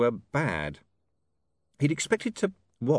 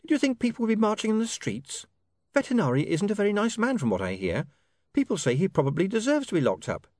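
put-on higher, brisk young woman's voice; 7 semitones higher than usual. A narrator's reading. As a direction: voice her lightly as a young woman. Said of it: "Sacharissa's voice, set against narration".

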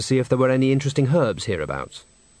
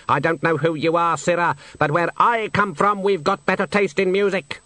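a snooty bird sneering; haughty swan; 7 semitones higher than usual. Acted. The swan scoffing at Death's song suggestion, high and disdainful.

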